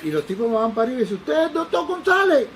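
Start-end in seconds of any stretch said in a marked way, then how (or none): 1.2-2.5 shouting. Retold or imitated. Drunk man shouting at the doctor across the airport. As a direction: bellow the question across the room.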